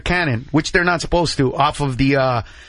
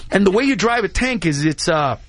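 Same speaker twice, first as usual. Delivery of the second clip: ordinary talk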